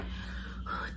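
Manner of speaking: a long, breathy sigh of relief; relieved sigh. Retold or imitated. To her mom after the fever check, a relieved sigh. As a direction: let the air out like the worry just left.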